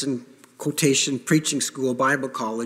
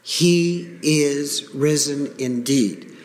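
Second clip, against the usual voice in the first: slow, solemn response; congregation response; much slower than usual. Acted. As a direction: answer back slowly and firmly. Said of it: "the congregation responds".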